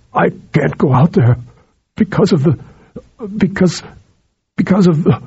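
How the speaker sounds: lightly tight, struggling voice; half-strangled. A narrator's reading. Harim confessing his fear to Sheeran, lightly strangled. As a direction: force the words out against fear. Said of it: "in a tight, husky, half-strangled tone".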